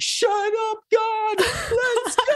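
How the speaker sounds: very high, squealing disbelief with a laugh; giddy shout of joy; excited squeal. Retold or imitated. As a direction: squeal it like you can't believe your luck. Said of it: "this moment where I was like".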